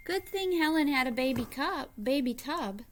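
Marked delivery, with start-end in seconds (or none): none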